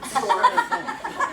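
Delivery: "Stork" said carefully with a laugh; re-say "Stork" clearly, laughing a little; corrected pronunciation. Shown as a demonstration. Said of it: "That's my Florida accent".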